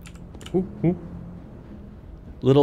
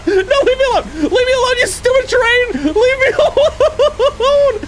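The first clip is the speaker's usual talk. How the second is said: high, loud, half-laughing panic; panicked laughing shriek; 3 dB louder than usual. Real, not acted. Gamer shrieking at the chasing train, panicked laughter. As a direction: scream it at the train, terrified but laughing. Streamer chased by the monster train.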